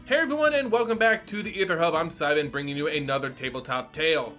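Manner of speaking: plain reading voice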